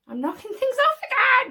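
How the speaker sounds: high-pitched, exasperated outburst; flustered exclamation. Real, not acted. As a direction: cry out at your own clumsiness. Knocking things over while filming.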